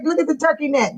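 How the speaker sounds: ordinary talk, nothing marked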